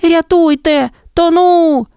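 a drowning hare calling for rescue; hare crying for help. A narrator's reading. Hare drowning in the flood, high panicked cry. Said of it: "Поруч почувся крик".

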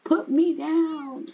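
high, drawn-out mock baby plea; protesting baby. Baby fussing in grandma's arms, high mock-plaintive voice. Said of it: "He's like".

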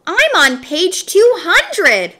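high, loud and slow boast; shouted. A narrator's reading. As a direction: yell it out to show off. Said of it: "Joy shouted".

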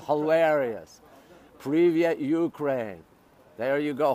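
normal talking voice

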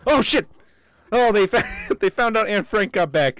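high, loud alarmed yell into a laugh; startled shout. Real, not acted. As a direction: yelp as the enemies return. Enemies find the character.

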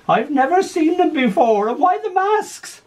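a gran who doesn't recognise her family; puzzled old gran. Acted. Confused old gran in care.

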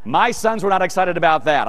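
ordinary delivery

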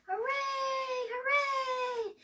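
very high, drawn-out cheer; cheering crowd. A narrator's reading. Crowd greeting the winner, high long cheer. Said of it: "The crowd cheered".

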